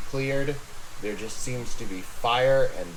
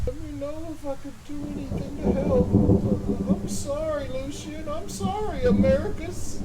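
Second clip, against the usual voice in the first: high, tearful confession; crying Clevis. Acted. Clevis to his captor, high and weepy. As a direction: sob it out while justifying.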